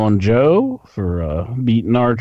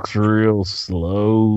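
slow talk; much slower than usual. Retold or imitated. Mimicking a friend's slow talk.

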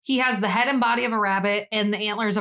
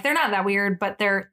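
ordinary delivery

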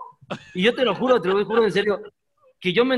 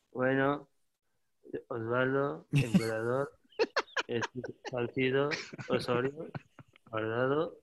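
much lower and softer, slow; mumbled lineup; 9 semitones lower, 11 dB quieter, slower than usual. Retold or imitated. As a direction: mumble the names barely audible. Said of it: "lo decía así". (La Volpe leyendo la alineación entre dientes)

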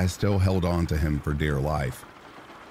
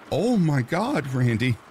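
alarmed yell; 9 semitones higher, 3 dB louder than usual. A narrator's reading. Wife yells on seeing her wounded husband, alarmed. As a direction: cry out at the sight of him. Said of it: "I yelled".

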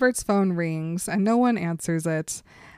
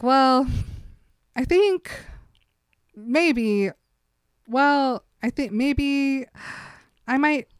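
hesitant Bella; 5 semitones higher, slower than usual. Retold or imitated. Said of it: "Bella's like".

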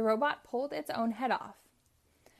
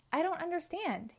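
high, puzzled cry; bewildered cry; 8 semitones higher than usual. Acted. Uncle Chuck losing control of his tractor, high bewildered cry. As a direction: sound baffled and helpless. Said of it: "he cried".

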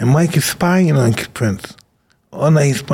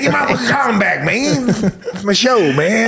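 high, laughing [name] yell; 8 semitones higher than usual. Retold or imitated. [name] yelling at [name] over the column, high.

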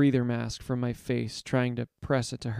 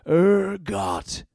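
loud, raised roar of acclaim; crowd roar; 8 semitones higher, 7 dB louder than usual. A narrator's reading. Followers yearning to be baptized, roaring. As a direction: roar the name out, higher and louder. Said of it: "they roar".

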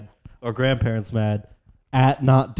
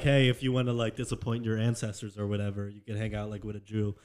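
soft, low, offhand pitch; quiet deadpan; 5 semitones lower, 9 dB quieter than usual. Retold or imitated. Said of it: "I'm like".

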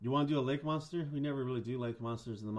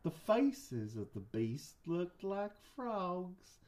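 slow, high-pitched Southern drawl; Southern guy; 4 semitones higher, much slower than usual. Pretend. Host narrates Loveland Frogs legend, slow Southern accent. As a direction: stretch it out like a Southern storyteller. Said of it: "I'll do a Southern guy".

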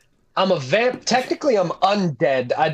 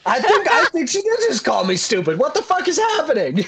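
high, laughing outrage; laughing indignation; 6 semitones higher than usual. Real, not acted. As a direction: laugh through the offence. Realising she was insulted.

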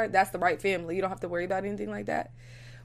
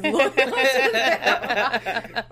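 laughing; 5 dB louder, much slower than usual. Real, not acted. Laughs mid-sentence.

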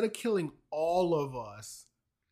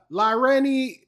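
flustered substitute teacher; 7 semitones higher, 10 dB louder, slower than usual. Pretend. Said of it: "we're reading it like the substitute teacher".

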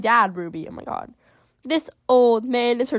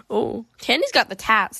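ordinary talk, nothing marked

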